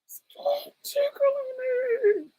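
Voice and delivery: very high, slow crying whisper; child's breathless crying plea to the uncle; whispered sobbing plea. Retold or imitated. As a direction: whimper it through tears. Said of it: "a devastating rage".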